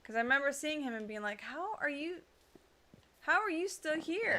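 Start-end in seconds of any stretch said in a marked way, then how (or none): 1.4-4.4 higher-pitched, incredulous retold question; retold disbelief. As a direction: ask it again in mock bafflement, pitched up. Retold disbelief at returning worker.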